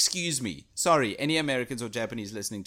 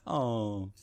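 a low, deflated oh; disappointed oh; 5 semitones lower, 5 dB quieter than usual. Retold or imitated. A crowd letting out a disappointed sigh. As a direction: let the air out of it, let down. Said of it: "just a".

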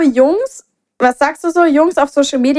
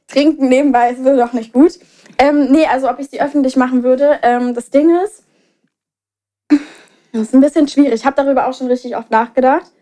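ordinary talk, nothing marked

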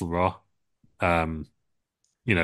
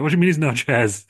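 higher and louder, hammed-up shock; mock disbelief; 7 semitones higher, 4 dB louder than usual. Triple H hears there are no chairs, mock disbelief. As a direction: ask it like you can't believe it.

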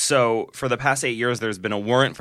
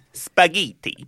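"Spaghetti" hammed-up in an Italian accent, higher; mock Giada Italian; 6 semitones higher, slower than usual. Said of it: "breaks into the Italian accent".

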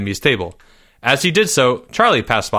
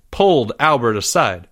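ordinary delivery, nothing marked

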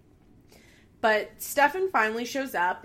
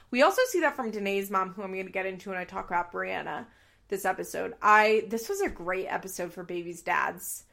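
ordinary talk

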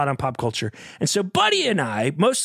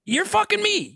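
outraged; 13 semitones higher than usual. Real, not acted. Outraged high shout.

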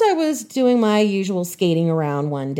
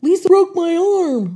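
put-on high, loud, tearful kid's cry; upset wail; 12 semitones higher, 6 dB louder than usual. Injured girl telling her dad what happened, loud tearful wail. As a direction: blurt it out crying to dad. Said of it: "And I told him".

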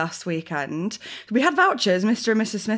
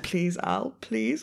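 hushed pleading; 7 dB quieter, much slower than usual. Retold or imitated. Husband begging weeping wife at dinner, hushed pleading. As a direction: imitate a soft, urgent plea.

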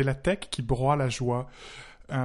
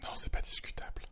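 whispering; 9 dB quieter than usual. Pretend. Contradiction taquine chuchotée.

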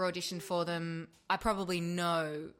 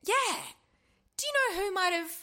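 much higher, louder puzzled agent; 14 semitones higher, 5 dB louder than usual. Retold or imitated. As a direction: play the baffled agent, pitched way up. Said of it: "And she was like".

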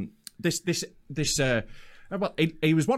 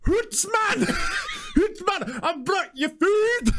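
Glaswegian shout; much slower than usual. Retold or imitated. Delivery man shouts up to the flats in a Glaswegian accent. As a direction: shout it up from the street in broad Glaswegian. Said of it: "Go on, say it again".

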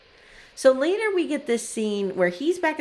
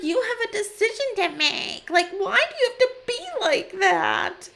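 whiny wife voice; 11 semitones higher than usual. Retold or imitated. Mimicking wife's whiny complaint.

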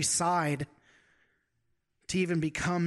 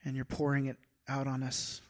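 closing prayer; 3 semitones lower, 4 dB quieter than usual. Acted.

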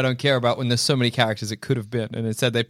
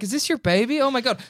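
mock outrage; 10 semitones higher than usual. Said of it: "I'd walk up and be like".

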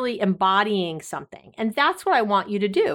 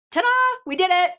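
bright, higher celebratory cheer; cheerful ta-da; 10 semitones higher, much faster than usual. Real, not acted. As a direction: announce the win with joy. Celebrating the end of episode.

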